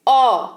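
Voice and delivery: औ said as an open, rounded 'aw'; teacher modelling the vowel; open au sound. Shown as a demonstration. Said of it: "कैसे उच्चारण होता है?".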